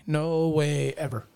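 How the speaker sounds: NO drawn out long and slow; emphatic denial. Real, not acted. Hunter ruling out two-man tents, emphatic denial. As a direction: stretch out NO for total dismissal.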